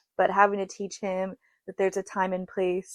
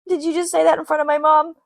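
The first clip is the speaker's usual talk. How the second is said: shocked daughter; 8 semitones higher, 5 dB louder than usual. Retold or imitated. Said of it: "the daughter's like".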